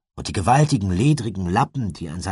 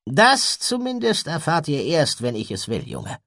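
langsam, laut einsetzend, dann tief abfallend; finstere Zurechtweisung; 10 semitones higher, 4 dB louder than usual. A narrator's reading. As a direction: weise den Jungen streng ab. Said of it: "machte ein finstres Gesicht". (finster und streng)

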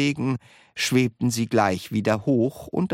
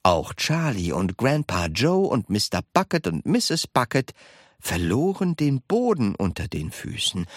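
ordinary delivery, nothing marked

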